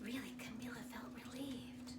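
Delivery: whispered relief. A narrator's reading. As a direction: breathe it out as a secret. Whispered narration.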